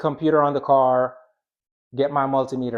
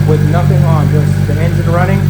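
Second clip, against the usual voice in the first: raised voice over engine; 6 semitones higher, 9 dB louder than usual. Real, not acted. Talking over a running engine.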